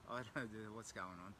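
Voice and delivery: ordinary talk, nothing marked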